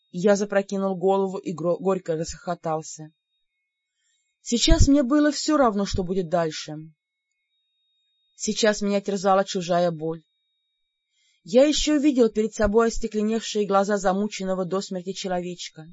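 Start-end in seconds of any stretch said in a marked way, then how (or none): none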